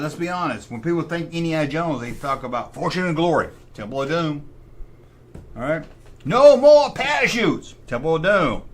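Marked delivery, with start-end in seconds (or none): 6.2-7.8 movie quote shout. Retold or imitated. Quoting movie line excitedly.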